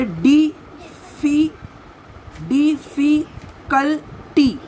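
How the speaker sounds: "डिफी-, डिफिकल्टी" very slowly, every syllable apart; split into syllables. Shown as a demonstration. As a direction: restart and beat out "डिफिकल्टी" with long gaps. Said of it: "इसको break करना है".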